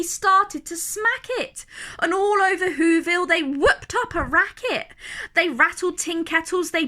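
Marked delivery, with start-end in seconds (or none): none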